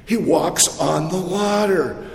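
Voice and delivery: usual speaking voice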